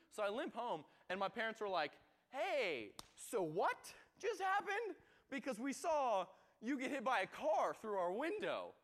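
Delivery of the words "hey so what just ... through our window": higher, louder worried parent voice; parents' alarmed questions. Retold or imitated. Parents confronting their son over the accident, alarmed. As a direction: play the shocked parent demanding answers.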